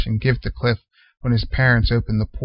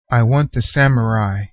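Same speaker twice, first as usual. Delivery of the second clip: ordinary delivery, nothing marked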